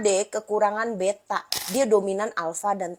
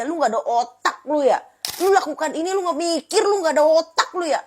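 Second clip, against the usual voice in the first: high, louder insult; mock scolding, a touch raised; scornful berating; 7 semitones higher, 4 dB louder than usual. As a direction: sneer at a thoughtless kid. People berating impulsive child.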